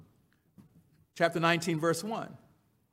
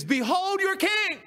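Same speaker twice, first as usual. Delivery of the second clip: Pilate proclaiming; 12 semitones higher than usual. Acted.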